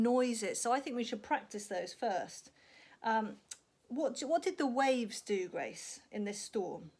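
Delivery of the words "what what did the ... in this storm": usual speaking voice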